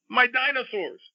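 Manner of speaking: very high-pitched storybook yell; Jackson's yell. A narrator's reading. Grandfather reading a child's panic from his book, mock yell. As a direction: squeal it like an upset little kid, acted. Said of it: "and Jackson yells".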